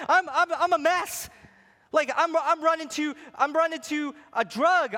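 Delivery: loud passionate pulpit preaching; preaching at full force. Acted. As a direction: drive it home to the congregation.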